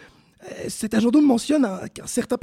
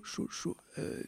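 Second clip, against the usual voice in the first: whispered joke; 3 semitones lower, 13 dB quieter, slower than usual. Acted. Joking aside about red-light district.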